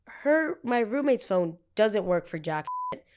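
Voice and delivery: ordinary delivery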